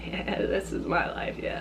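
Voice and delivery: very soft, resigned retold reply; hushed, deadpan acceptance. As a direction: drop to a near-whisper, giving in. Resigned reply to scared mom.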